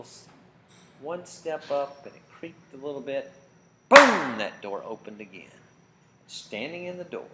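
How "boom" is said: a huge, much louder burst of sound; sudden boom. A narrator's reading. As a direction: slam it out like the door crashing open. Door bursts open in story.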